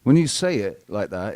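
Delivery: ordinary talk, nothing marked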